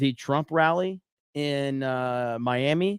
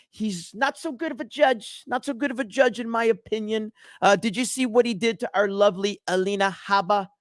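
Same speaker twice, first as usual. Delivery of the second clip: higher, hammed-up blustering politician voice; cringy Trump impression; 4 semitones higher than usual. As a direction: puff it up like a bragging showman at a rally. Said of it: "That's as best I could do right there".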